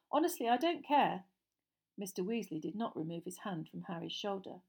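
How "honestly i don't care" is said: plain reading voice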